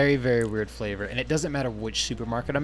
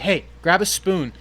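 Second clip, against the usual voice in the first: calling out; 6 semitones higher, 6 dB louder, slower than usual. Retold or imitated. Calling a coworker over.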